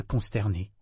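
ordinary delivery, nothing marked

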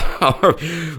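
high, free, open laughter; hearty laugh. Acted. Holmes laughing at the doctor's insults, heartily. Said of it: "rit de bon cœur".

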